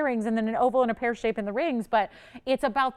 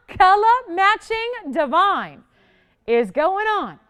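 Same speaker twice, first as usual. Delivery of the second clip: higher, louder and slow, savouring each word; thrilled gushing; 8 semitones higher, 7 dB louder, slower than usual. Real, not acted. Host gushing to viewers over the color match, delighted.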